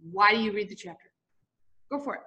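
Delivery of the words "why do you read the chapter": ordinary delivery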